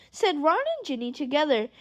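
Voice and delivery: ordinary delivery, nothing marked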